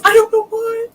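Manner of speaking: loud distorted mic. Real, not acted. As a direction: voice clipping through a loud mic. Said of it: "It got so loud".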